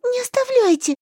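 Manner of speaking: high desperate plea; pleading. Acted. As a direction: cry out not to be left. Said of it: "жалобно взмолилась я".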